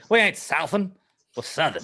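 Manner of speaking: hammed-up Southern accent, starting loud and high; mock Southern twang, punched at the start. Podcaster mimics Southerners correcting the word, mock Southern accent. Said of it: "you pick up the Southern accent".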